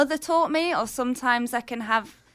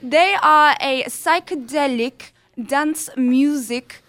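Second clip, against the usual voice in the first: guest hamming up a put-on accent, louder and slow; playful fake accent slipping into Yorkshire; 2 semitones higher, 4 dB louder than usual. Guest introducing a song on air, in a put-on accent. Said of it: "I'm going to stop this accent now".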